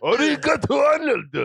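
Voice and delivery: loud high mock Japanese cartoon shout; mock dub shout. Mocking a Japanese dub of Hey Arnold, high shouted. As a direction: yell it like an overdubbed anime hero.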